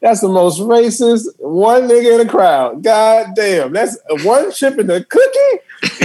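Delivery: high, slow, laughing amazement; real laughter at the audacity; tickled laughing. Real, not acted. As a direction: marvel at it while cracking up. Laughing about the racist line.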